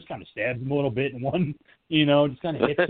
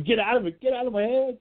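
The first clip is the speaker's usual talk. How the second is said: high, mock-desperate outburst; tormented Michael; 9 semitones higher than usual. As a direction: act out someone driven mad by a voice. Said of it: "just smacking his head, going".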